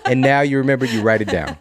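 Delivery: high, loud words through laughter; real laughter easing off as you talk; laughing. Real, not acted. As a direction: push the point out while laughing. Laughing on after the joke.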